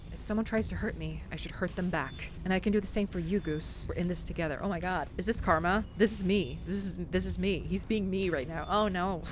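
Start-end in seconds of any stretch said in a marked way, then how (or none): none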